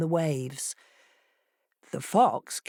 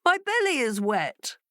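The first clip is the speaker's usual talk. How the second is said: higher, fretful fox voice; fox complaining; 7 semitones higher than usual. A narrator's reading. Fox sinking into the sea, mildly fretful. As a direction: whine it.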